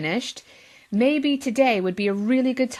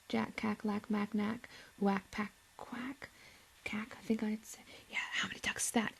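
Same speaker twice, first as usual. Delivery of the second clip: much softer whispered counting; hushed muttered counting; quiet aside; 18 dB quieter than usual. Real, not acted. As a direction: whisper as you count them up.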